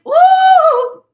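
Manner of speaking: very high, loud spooky woo; a sudden wail for the universe; high mystical woo. Acted.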